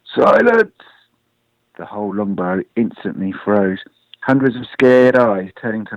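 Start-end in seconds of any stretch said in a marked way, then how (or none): 0.0-0.7 loud, high commanding bellow. A narrator's reading. Chief Lopkin to the panicking warren, bellowing. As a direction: bellow for order over a hubbub. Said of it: "breathe deep and then bellow".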